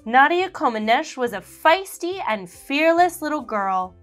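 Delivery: ordinary delivery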